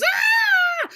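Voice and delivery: short shrill scream of fright; high scream. Acted. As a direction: scream in terror. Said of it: "Julie screams".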